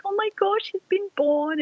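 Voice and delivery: high, delighted squeal of news; excited sisters. Retold or imitated. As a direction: pitch way up, gasping with joy. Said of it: "they were like".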